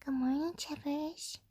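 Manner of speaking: soft whispered pet greeting; whispering. Real, not acted. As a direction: whisper good morning to the dog. Greeting her pet dog.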